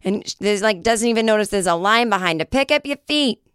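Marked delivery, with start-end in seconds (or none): 2.5-3.4 higher Boston threat; threatening Boston accent. Retold or imitated. Host repeating sister's line as a Boston threat. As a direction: warn them in a Boston accent. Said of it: "Sounds like a threat".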